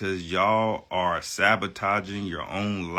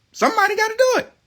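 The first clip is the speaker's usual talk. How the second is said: mock squeaky repeat; 7 dB louder, faster than usual. Ranting vlogger mocks his own line in a squeaky voice. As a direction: jump way up high and fast for the repeat.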